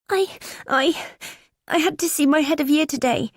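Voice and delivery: high, halting, close to tears; a girl choking out words through crying; tearful teen girl. Acted. Daughter sobbing to her mum on the phone, tearful. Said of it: "Her crying intensifies".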